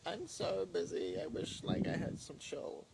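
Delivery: high, slow whiny busy-student voice; mock busy student. Teasing a bored viewer about their future busy college self, high and whiny. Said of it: "you're gonna be like".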